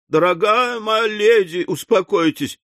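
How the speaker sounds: high, emotional entreaty; moved almost to tears. A narrator's reading. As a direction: beg the lady to calm down. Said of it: "чуть не до слёз растроганный этим проявлением сочувствия".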